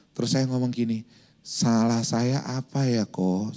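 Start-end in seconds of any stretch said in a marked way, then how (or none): 1.4-3.5 slow, soft, polite ask; calm soft question to the young driver; gentle question. Retold or imitated. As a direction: ask meekly and slowly, no anger. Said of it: "Saya bilang".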